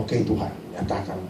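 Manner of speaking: low, soft murmur to God; quiet prayer. Retold or imitated. Exorcist asking God to show power, quiet prayer. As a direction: say it privately, well under your voice.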